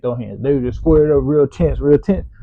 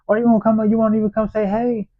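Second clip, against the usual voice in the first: mocking the boy; 10 semitones higher than usual. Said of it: "He's like".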